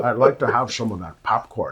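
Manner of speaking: mock Scottish accent with slushy S's; amused, put-on Scottish burr; Sean Connery. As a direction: do a jokey Scottish film-star impression. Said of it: "it would be so Sean Connery".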